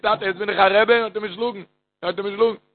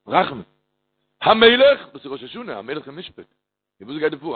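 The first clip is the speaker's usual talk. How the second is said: ordinary talk, nothing marked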